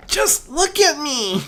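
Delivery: high, loud, slow wail; a young dragon wailing his frustration; anguished wail. A narrator's reading. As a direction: cry it out in anguish. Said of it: "Spike wailed out".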